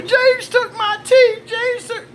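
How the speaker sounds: very high, stretched mock wail; freaked-out wife. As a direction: imitate a hysterical wife tattling to everyone. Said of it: "And she freaked out. She called everybody".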